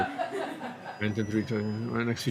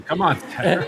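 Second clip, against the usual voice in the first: laughing; 8 semitones higher, 4 dB louder than usual. Real, not acted. Teasing a racer out of gas.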